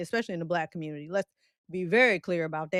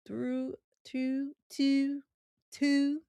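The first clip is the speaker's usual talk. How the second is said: Baltimore accent try; 5 semitones higher, much slower than usual. Shown as a demonstration. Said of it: "how they say it".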